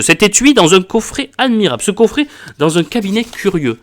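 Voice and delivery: high, loud outraged tirade, building clause by clause; loud, high indignation, some words slowed for weight; indignant pompous doctor. Acted. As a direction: play the offended doctor swelling with pride and fury. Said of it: "la réponse du docteur, je trouve qu'elle est géniale".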